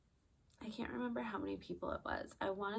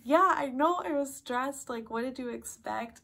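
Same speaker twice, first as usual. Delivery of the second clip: loud, high, stressed-out retort; worked-up protest; 7 semitones higher, 8 dB louder than usual. Retold or imitated. Stressed trainee answering her trainer's surprise at her talking, worked-up protest. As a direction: re-enact an exasperated outburst, starting loudest and easing off.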